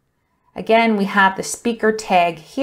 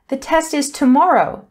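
higher, excited announcement; exclaimed; 6 semitones higher than usual. Shown as a demonstration. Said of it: "the teacher exclaimed".

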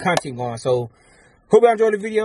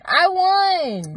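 squeaky high voice; 12 semitones higher than usual. Pretend. Silly back-and-forth of "I won.

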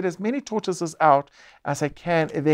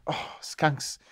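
whispered dread; 8 dB quieter than usual. Acted. Dreading the skunk card.

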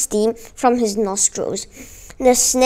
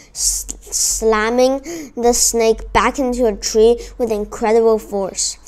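usual speaking voice

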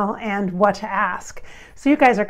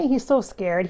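normal talking voice

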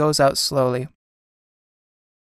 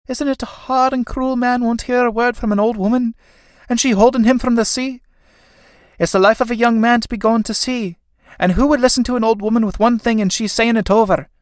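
put-on high, loud, lamenting old woman; distressed old mother; 8 semitones higher, 3 dB louder than usual. Old mother pleads with departing son, high lament.

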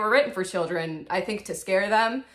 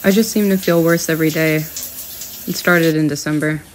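dramatic confessional monologue; 4 semitones lower, 9 dB louder than usual. Acted. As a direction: deliver it like a moody film narration. Dramatic mock-diary monologue, loud.

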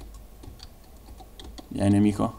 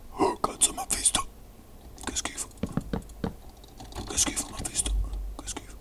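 hushed frustrated whisper; whispering while hiding; 15 dB quieter than usual. Real, not acted.

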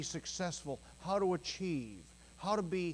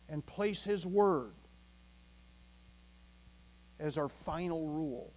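ordinary talk, nothing marked

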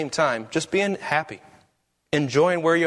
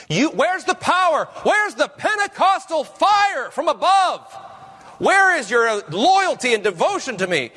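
booming rebuke; 9 semitones higher than usual. Acted. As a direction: thunder down a rebuke on the prophet. Preacher voicing a stern rebuke.

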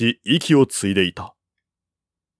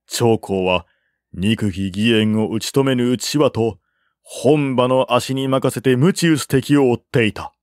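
usual speaking voice

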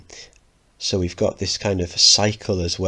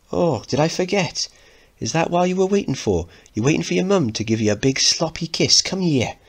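high teasing mum; 10 semitones higher than usual. Acted. Mother teasing son.

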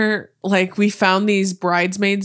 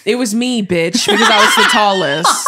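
laughing; 2 semitones higher, 5 dB louder than usual. Real, not acted. Laughing protest about always being the boy.

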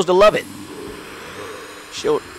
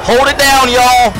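urgent call; 6 semitones higher, 5 dB louder than usual. Real, not acted. Alarm, urging team.

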